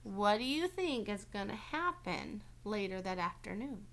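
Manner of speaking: ordinary delivery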